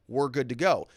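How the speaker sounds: ordinary talk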